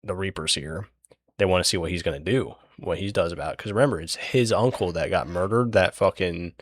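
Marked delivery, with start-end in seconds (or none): 0.0-0.9 softer, hushed murmur of a crowd. Retold or imitated. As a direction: drop your voice like a nervous crowd. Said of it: "the crowd kinda goes quiet".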